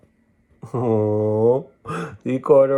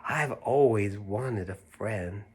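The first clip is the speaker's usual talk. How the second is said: tender bear; 2 semitones lower, 7 dB quieter, slower than usual. Acted. Corduroy speaks tenderly.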